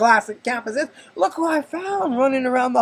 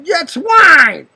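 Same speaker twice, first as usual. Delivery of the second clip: louder, higher blurt; blurted confession; 8 semitones higher, 11 dB louder than usual. Retold or imitated. Husband caught drinking, blurting loud. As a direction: give up and blurt it out. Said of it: "I was".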